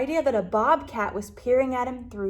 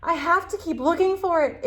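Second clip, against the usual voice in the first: higher, eager determined voice; exclaiming; 7 semitones higher, 4 dB louder than usual. Acted. Lizard vows to keep searching, eager and high. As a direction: insist with bright urgency. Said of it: "exclaimed little lizard".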